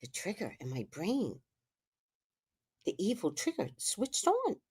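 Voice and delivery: very soft, suspenseful telling; hushed dramatic confession; ominous hush. Real, not acted. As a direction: drop almost to a whisper for menace. Hushed dramatic telling of rising anger.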